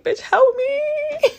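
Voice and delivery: slow high whine for help; desperate plea. Retold or imitated. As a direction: stretch it out, begging. In hair grip, begging friend.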